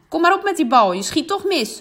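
loud, high taunt; calling out. Acted. Goalkeeper taunting the penalty taker, loud call. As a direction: dare him to shoot. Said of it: "roept ze naar Pieter".

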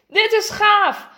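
high, loud and stretched out; thrilled, drawn-out exclamation; excited call. Acted. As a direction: shout it with boyish delight. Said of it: "roept Jasper".

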